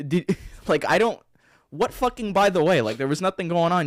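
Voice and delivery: loud, high, exasperated disbelief; incredulous protest. Real, not acted. Co-host challenging his friend's by-the-way phone call, incredulous. Mock-outraged at friend's casual wording.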